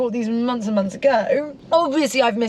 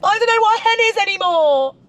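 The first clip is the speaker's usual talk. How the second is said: higher, louder, mock-tragic wail; exasperated despair; 10 semitones higher, 4 dB louder than usual. Real, not acted. Telling her friend about the hen-party mess, exasperated. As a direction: throw up your hands at the whole idea.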